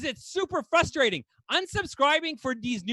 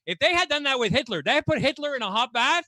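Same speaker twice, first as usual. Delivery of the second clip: normal talking voice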